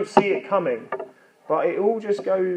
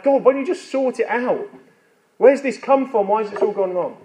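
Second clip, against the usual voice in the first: higher, exasperated plea; crying out to God; 6 semitones higher than usual. Acted. Job questioning God as life falls apart, crying out. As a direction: demand answers from God, pitched up. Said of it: "he's crying out to God".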